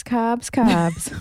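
put-on playful Boston accent on carbs; a quick jokey Boston accent. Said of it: "my Boston accent".

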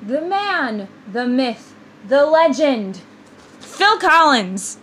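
building announcer intro, slow on the name; grand introduction. Acted. Host announcing [name] grandly. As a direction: hype the name like a game show host.

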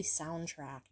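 soundtrack said as "soundtract", with an added t; habitual mispronunciation. Real, not acted. Vlogger puzzled by own habit, soundtrack said as "soundtract". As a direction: end soundtrack like the word "tract". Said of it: "I don't know why I always say".

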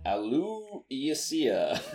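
Alucia stretched slow, syllable by syllable; drawn-out name. Shown as a demonstration. Mage hunter sounding out his own name Alucia, very slowly. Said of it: "The I is silent".

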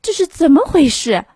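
惊讶地问; startled question. Acted. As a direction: ask it aghast. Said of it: "妈妈气喘吁吁地问".

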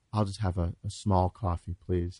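sweetly meek customer voice; super sweet mode. Retold or imitated. Ordering coffee meekly from the barista, super sweet. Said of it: "I go into super sweet mode".

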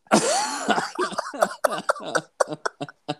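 shrieking wheeze laugh; high-pitched laugh. Real, not acted. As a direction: laugh until you squeal. Hysterical laugh after the taco joke.

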